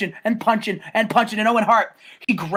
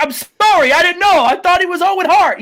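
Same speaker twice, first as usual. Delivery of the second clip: higher and louder, frantic apology; mock panic; 10 semitones higher, 6 dB louder than usual. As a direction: beg forgiveness in a panic. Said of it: "He's, like, freaking out".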